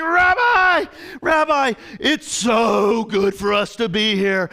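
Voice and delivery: loud fanboy shout, then drawn-out gush; hammed-up overexcited disciple; starstruck gushing. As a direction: hail your idol, overcome with excitement. Acting out starstruck, flustered Peter.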